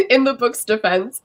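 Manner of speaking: ordinary talk, nothing marked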